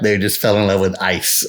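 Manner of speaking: normal talking voice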